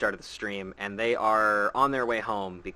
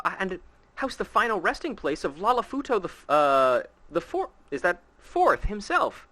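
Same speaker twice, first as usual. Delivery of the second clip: lightly voiced scholar; 7 semitones higher than usual. Reads NPC quest dialogue aloud.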